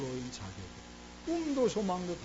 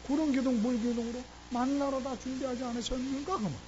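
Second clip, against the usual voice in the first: rising, ringing preacher's build, much louder; a pastor's fervent sermon climax; full-force preaching; 8 semitones higher, 6 dB louder than usual. Real, not acted. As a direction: drive it home to the congregation like a revival preacher.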